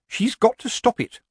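high, insistent complaint; indignant committee woman. A narrator's reading. Committee woman demanding Nanny intervene, indignant. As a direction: voice a put-out busybody, pitched well up.

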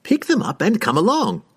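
high, breezy instruction; a cheerful host giving orders; wizard voice. A narrator's reading. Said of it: "he instructed".

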